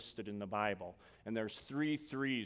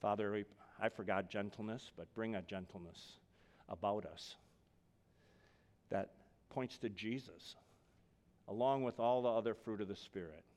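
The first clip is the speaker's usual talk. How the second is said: quiet prayer; 4 semitones lower, 4 dB quieter than usual. Acted. Pastor closing the sermon in quiet prayer. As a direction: pray it gently to the Father.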